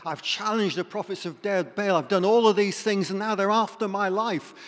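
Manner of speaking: frustrated outburst. Retold or imitated. Elijah complaining to God, frustrated and loud. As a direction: pour out anger and frustration. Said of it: "he poured out his anger and his frustration".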